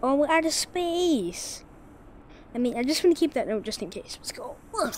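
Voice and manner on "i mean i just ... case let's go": whisper. Real, not acted. Aside while sneaking.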